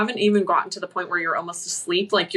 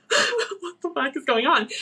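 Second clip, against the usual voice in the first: higher-pitched retold panic; bewildered, pitched-up alarm; freaking out; 5 semitones higher than usual. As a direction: act out a mild freak-out. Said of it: "freaking out".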